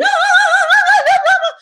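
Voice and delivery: very high, loud squealing ah; screechy high scream. Retold or imitated. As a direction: shriek it high like the old direction. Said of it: "go higher and higher and scream".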